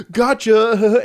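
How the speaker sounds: high loud triumphant gotcha; gleeful little gotcha. Retold or imitated. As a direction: sprung the trap. Brother reveals prank.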